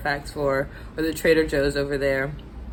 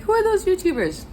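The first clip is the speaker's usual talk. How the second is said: confused; 13 semitones higher than usual. Retold or imitated. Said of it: "And I was like".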